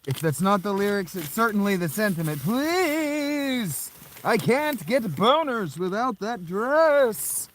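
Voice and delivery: very high, loud whiny plea; stupid voice. Pretend. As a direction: beg in a silly squeaky voice. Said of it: "I did that stupid voice".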